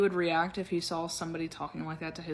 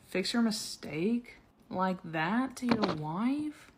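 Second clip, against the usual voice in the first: slow disbelief; 2 semitones higher, much slower than usual. Real, not acted. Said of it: "This is really not how you talk to people".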